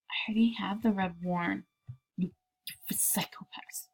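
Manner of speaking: soft breathy muttered complaint; whisper. Real, not acted. Player whispering annoyed at other traders. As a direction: grumble it under your breath.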